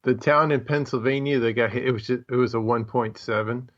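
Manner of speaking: ordinary talk, nothing marked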